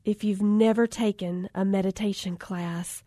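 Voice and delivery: slow, soft meditation-teacher voice; zen instructor. Retold or imitated. Instructor addressing her only student, slow soft zen voice. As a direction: float it out like a calm yoga guide. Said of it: "she's kinda got her zen on".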